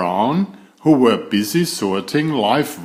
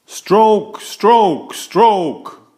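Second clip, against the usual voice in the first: high, loud rhythmic calls, falling each time; calling the stroke; 9 semitones higher, 5 dB louder, much slower than usual. A narrator's reading. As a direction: drive the paddlers in rhythm. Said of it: "called Brother".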